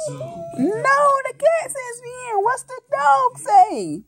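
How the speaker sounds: high, drawn-out exasperated plea; mock exasperation. Real, not acted. Parent exasperated at child's repeated mistakes.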